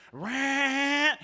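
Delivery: high, held revving-engine noise; imitated engine rev. Driver throwing car in reverse, imitated engine whine. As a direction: mimic a car straining in reverse.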